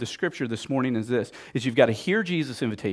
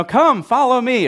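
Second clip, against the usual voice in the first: raised, higher call; 9 semitones higher, 7 dB louder than usual. Retold or imitated. Jesus calling fishermen from the coast, raised voice. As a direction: beckon loudly across the water. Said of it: "He's yelling from the coast".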